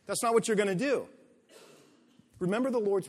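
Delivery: loud, fast, driving sermon delivery; punchy, loud pulpit voice; full-force preaching. Acted. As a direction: preach it hard to the congregation.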